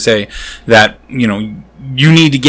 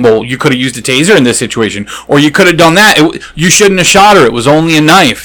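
mocking critics; 5 semitones higher than usual. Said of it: "all these people saying".

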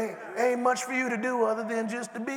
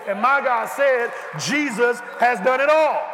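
raised, slow proclamation; preaching shout; 4 semitones higher, 4 dB louder than usual. Acted. Preacher proclaiming to the congregation, shouting. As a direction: cry it out to the church.

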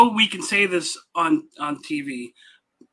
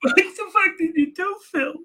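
angry friend impression; 9 semitones higher than usual. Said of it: "he's like".

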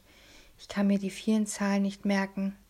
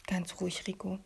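self-calming; 7 dB quieter than usual. A narrator's reading. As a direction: talk yourself down quietly. Boy calming himself down.

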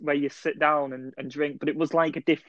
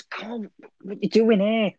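urgent friend; 7 semitones higher than usual. Retold or imitated. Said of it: "He goes".

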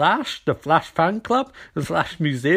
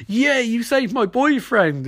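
high, slow drawn-out yay; hammed-up grateful delight; mock cheering; 6 semitones higher, slower than usual. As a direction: cheer in imitation, stretched and lilting. Acts grateful detective cheering.

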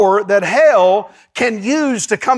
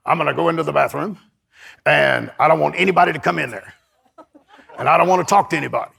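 put-on lower, fast old man's voice; hint at the old preacher, laughing through it; imitating Sumrall; 5 semitones lower, faster than usual. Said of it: "he talked like this".

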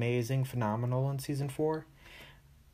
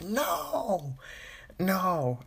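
high-pitched, drawn-out cry of protest; exaggerated "no", soaring then easing down; mock dismayed no; 7 semitones higher than usual. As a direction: wail it way up high in hammed-up dismay. Mock dismay at Roy walking in.

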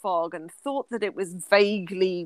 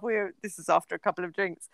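ordinary talk, nothing marked

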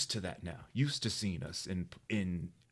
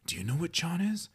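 high, puzzled and a bit louder; boy asking his friends, high and puzzled; baffled boy asking around; 10 semitones higher, 4 dB louder than usual. Retold or imitated. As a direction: turn to the other boys, pitched high and confused. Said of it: "and he goes".

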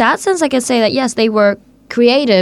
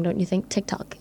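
whispering; 2 semitones lower, 12 dB quieter than usual. Real, not acted. Savoring the name.

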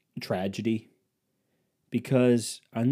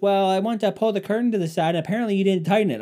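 higher, louder, fast whiny wife; wife explaining the fallen curtain rod, higher accusing tone; mock wife blaming; 8 semitones higher, 5 dB louder, faster than usual. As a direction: rattle off the blame in a higher voice. Wife blaming him, acted quarrel.

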